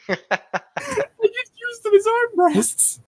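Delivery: high, slow words through laughter; laughing. Real, not acted. Joking to friend about using short people as armrests, laughing. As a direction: laugh the joke out.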